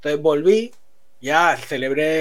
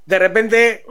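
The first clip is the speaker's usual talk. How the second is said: ordinary talk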